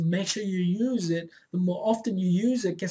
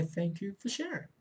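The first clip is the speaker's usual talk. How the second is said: much softer, gently saying "Thank you for sharing"; soft calm acknowledgement; 10 dB quieter than usual. Shown as a demonstration. Coach showing how to answer the inner voice, softly and calmly. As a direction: say "Thank you for sharing" quietly, like soothing a child. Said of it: "Turn to it and say".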